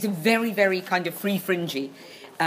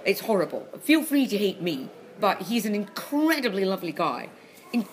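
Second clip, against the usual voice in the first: ordinary talk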